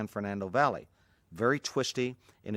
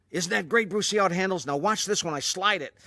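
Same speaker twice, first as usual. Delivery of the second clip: much higher and louder, revved up; gleeful boast; 9 semitones higher, 5 dB louder than usual. Retold or imitated. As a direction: crow about the car over the engine. Steve driving wildly, boasting.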